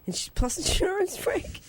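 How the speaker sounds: high incredulous squeak; can't believe the offer, laughing; stunned echo of plus insurance, voice way up. Retold or imitated. Astonished echo of job offer.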